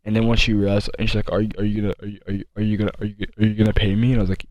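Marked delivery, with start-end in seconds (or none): 1.3-4.1 lightly voiced, halting dazed girl stuck on repeats; mild mock of a spaced-out classmate stumbling over her words; imitating a sedated girl. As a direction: mimic someone too out of it to finish a thought. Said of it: "how she was talking".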